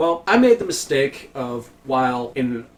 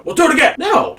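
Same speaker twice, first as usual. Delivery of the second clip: very high, loud back-and-forth; heated exchange; 14 semitones higher, 7 dB louder, faster than usual. Retold or imitated. Worker and supervisor trading refusals, high and loud. Acted quarrel.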